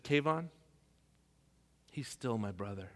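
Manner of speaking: calm dad. Retold or imitated. Dad's calm reply on phone.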